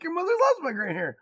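high, loud mock defence; mock indignant dad. Dad retells defending his gray hair to his daughter, loud. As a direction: protest in playful outrage. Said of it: "I'm like".

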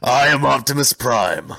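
loud, slow heroic robot leader; Optimus Prime. Pretend. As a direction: proclaim it as a mock-epic robot hero. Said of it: "Just do a whole podcast like that".

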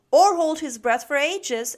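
loud, high, butting in; eager interjection. A narrator's reading. Max adding to Snip's list, eagerly chiming in. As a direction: chime in forcefully. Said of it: "added Max".

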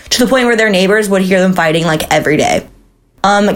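usual speaking voice

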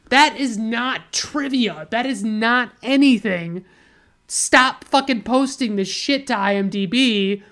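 emphatic, indignant, pitch pushed up; exasperated rant. Real, not acted. Reviewer scolding whoever posted pointless trivia, exasperated. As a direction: lay into it word by word, then incredulous.

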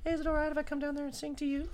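very high, soft falsetto imitation; mock-gentle pop star asking sweetly; put-on soft, high singer voice. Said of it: "Fucking with his soft voice".

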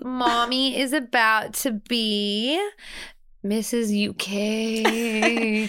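slow, drawn-out showbiz announcement; slow regal proclamation of the title; grand announcement. Pretend. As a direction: unveil it like a pageant title. Announcing her big news grandly.

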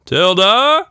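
loud calling out the name, rising; father calling. A narrator's reading. Father calling Tilda from the house, loud call. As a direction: call across the yard for your daughter. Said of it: "Tilda's father calling".

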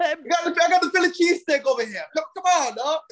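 loud New York street voice; New York accent. Pretend. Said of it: "Yo, I'm from Queens".

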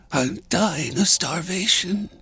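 high, feeble, starving woman; weak woman. A narrator's reading. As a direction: moan faintly in a high voice. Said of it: "the weak woman gasped".

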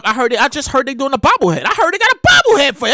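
normal talking voice

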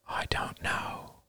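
very slow, barely audible whisper. A narrator's reading. Words scrolling across Roman's face, whispered. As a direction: breathe it out without voice.

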